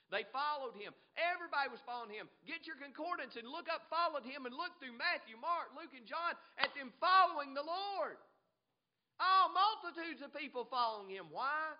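high, loud preacher's delivery building to a shout; full-force preaching. Real, not acted. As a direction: build and cry it out to the congregation.

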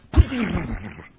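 loud sputtering whoosh; sharp burst trailing into a flutter; imitated getaway noise. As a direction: mimic a sudden scramble away with a noise. Imitating men scattering in dust.

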